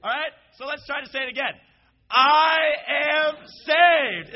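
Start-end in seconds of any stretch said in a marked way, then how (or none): 2.1-4.3 very loud, slow, high shout of "I am saved"; happy rallying shout; joyful shout. Shown as a demonstration. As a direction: beam and belt "I am saved". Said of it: "you can't say it with a frown".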